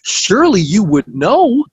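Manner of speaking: high-pitched mock disbelief; retold astonishment, climbing high; incredulous protest. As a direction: protest as if it can't be true. Retelling his incredulous protest to her.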